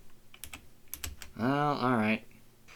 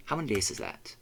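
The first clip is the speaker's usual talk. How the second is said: normal talking voice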